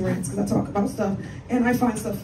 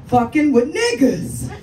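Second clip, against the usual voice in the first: slow, loud punchline, each word hit hard; 2 semitones higher, 7 dB louder, much slower than usual. Real, not acted. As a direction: slam the punchline home slowly. Punchline shouted out.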